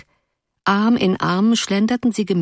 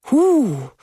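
high, louder awed hoo; moved hoo; 7 semitones higher, 4 dB louder than usual. A narrator's reading. Girl moved by the poem, a high awed hoo. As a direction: let out a shaken little hoo. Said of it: "ordentlich ergriffen".

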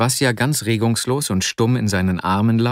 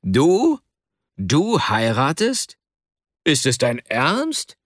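higher, drawn-out incredulous questions; astonished outcry, rising at the end, not a full scream; frantic astonishment; 4 semitones higher, slower than usual. A narrator's reading. Akasha hears his friend is marrying, astonished cry. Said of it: "schrie Akasha wie besessen".

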